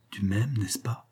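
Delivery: coaxing tenderly. A narrator's reading. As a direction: coax him like a lover. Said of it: "d'une voix pleine de câlinerie". (voix câline et douce)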